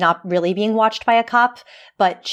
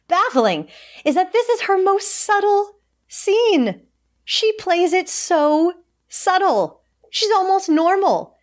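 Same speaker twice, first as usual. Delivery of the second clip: baffled; 10 semitones higher than usual. Real, not acted. Exasperated high-pitched disbelief.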